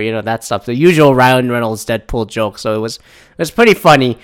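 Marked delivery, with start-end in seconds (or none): none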